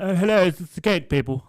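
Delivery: high, loud, fussy pensioner; old lady voice. Retold or imitated. Fitter mimicking the customer's phone call, pitched high. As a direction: squeak it out like the dotty customer. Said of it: "She's like".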